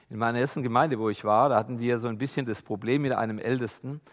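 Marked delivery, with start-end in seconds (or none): none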